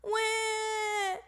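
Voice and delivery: high, long held mock wail; hammed-up child's bawl; drawn-out high crying wah. A narrator's reading. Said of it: "wailed Polly".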